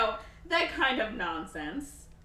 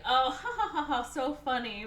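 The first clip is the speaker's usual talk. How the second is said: higher, louder fake chuckle; put-on laugh; 8 semitones higher, 5 dB louder than usual. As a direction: politely laugh it off, hammed up. Roxanne laughs off the declaration.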